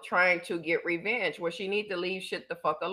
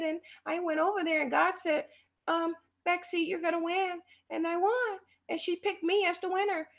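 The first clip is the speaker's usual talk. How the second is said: mock Becky voice; 11 semitones higher than usual. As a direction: play a naive woman bragging she won. Mocking contest winner Becky.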